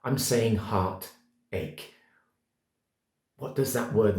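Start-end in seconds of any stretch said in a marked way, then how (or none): 0.0-1.9 slow, weighted heartache; heartache dwelt on slowly. Shown as a demonstration. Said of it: "I'm not saying pain or sadness or upset".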